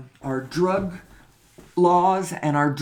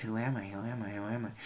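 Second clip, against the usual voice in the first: repeated softly, much quieter, like a worn-out mantra; mock repetitive mumble; 7 semitones lower, 11 dB quieter, much faster than usual. Mocking his own habit of asking, in a soft droning repeat. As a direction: mumble it over and over to yourself, fading. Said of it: "I shouldn't just keep saying the words".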